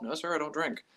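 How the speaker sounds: soft, sleepy denial; feigned tiredness. Pretend. Answering the cop while faking drowsiness, soft and tired. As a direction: sound drained and harmless. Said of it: "I pretended I was tired".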